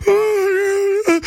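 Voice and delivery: high, whining mock outrage; mocking whiny critics. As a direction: wail like scandalized complainers. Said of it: "People are like".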